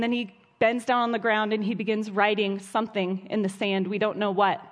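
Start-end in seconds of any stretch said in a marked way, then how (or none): none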